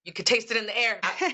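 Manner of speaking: words broken up by laughter; giggling over the Bigfoot joke; laughing. Real, not acted. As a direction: laugh through the line.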